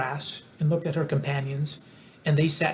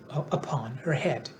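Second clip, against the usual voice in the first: ordinary delivery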